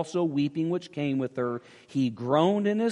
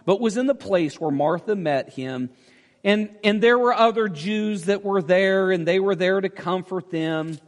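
loud, driving preacher's cadence, pitch raised; full-force preaching; 6 semitones higher, 6 dB louder than usual. Acted. Pastor to congregation, preaching at full force. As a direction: preach it hard to the back row. Preaching the Lazarus passage loudly.

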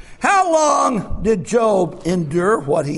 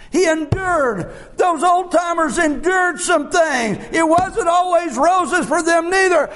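full-force preaching; 8 semitones higher than usual. Acted. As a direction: drive it home to the congregation. Preacher builds again.

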